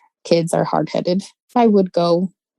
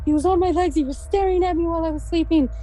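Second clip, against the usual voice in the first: retold crying and screaming; 11 semitones higher, faster than usual. Frightened guest insists to the sisters, high and tearful. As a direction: mimic a panicked, tearful child. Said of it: "she's crying and she's crying and screaming".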